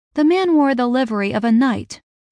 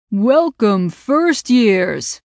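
loud, roaring welcome to a crowd; booming voice; 6 dB louder, much slower than usual. A narrator's reading. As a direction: boom it out across the yard. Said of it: "a booming voice roared".

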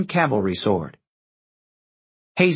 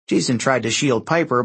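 usual speaking voice

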